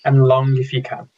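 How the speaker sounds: calm meditation guide with long pauses between phrases; unhurried, measured instructions, leaving silence after each; guided meditation. Real, not acted. Meditation leader guiding an online mindfulness group, calm and spaciously paced.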